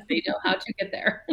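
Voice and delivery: words broken by a real laugh. Real, not acted. Mom joking to her co-host about her kids, laughing. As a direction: laugh right through the joke.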